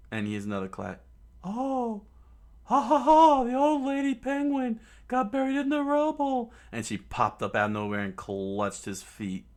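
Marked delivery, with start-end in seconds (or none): 1.4-6.5 very high, squeaky cackle, then a taunt; gloating laugh. Acted. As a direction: crow with glee over the villain. Usopp gloating over the villain.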